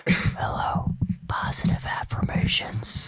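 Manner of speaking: close-mic breathy whisper; ASMR whisper. Pretend. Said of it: "whisper into the microphone".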